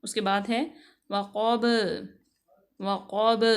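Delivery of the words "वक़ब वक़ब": वक़ब with the final ब bounced, a clear echo; qalqala on the final ba. Shown as a demonstration. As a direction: let the last ब pop out. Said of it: "बा को बिल्कुल तौर पे वाज़ेह करेंगे".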